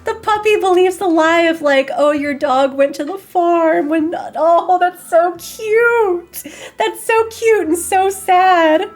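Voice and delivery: high, slow, squealing aww voice; gushing over cuteness. Real, not acted. Streamer reacting to the naive puppy, high cooing squeal. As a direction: melt over how cute it is.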